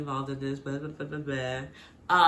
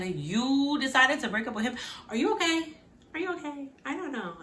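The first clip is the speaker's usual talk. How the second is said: high-pitched sarcastic questioning; mock concern; 7 semitones higher than usual. Host questions Belly's breakup decision, mock concerned. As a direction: ask it high, as if doubting her sanity.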